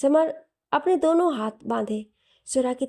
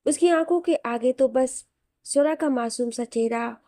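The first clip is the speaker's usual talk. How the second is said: ordinary delivery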